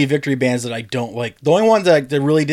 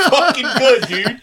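ordinary talk

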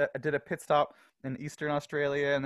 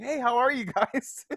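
nervous greeting; 10 semitones higher, 4 dB louder, slower than usual. Retold or imitated. Greeting islanders on bike.

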